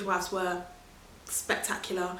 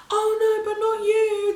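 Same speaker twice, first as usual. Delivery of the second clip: loud, high giddy protest; 13 semitones higher, 8 dB louder than usual. Retold or imitated. Patient telling the midwife not you, loud and giddy. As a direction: squeal it like a woozy patient. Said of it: "I was so high on gas and air".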